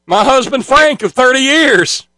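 high-pitched hammed-up speech voice; mock a candidate proudly declaiming; Carly Farina. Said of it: "Carly Farina was a talking about her husband".

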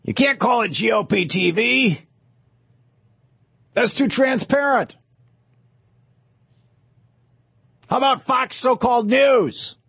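high, hammed-up fake Australian; terrible Australian accent. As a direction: put on a big bad Australian voice. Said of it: "I do a terrible Australian accent".

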